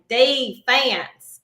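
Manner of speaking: high country twang yell on Defense; Southern country twang. Retold or imitated. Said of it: "With, like, a real country twang".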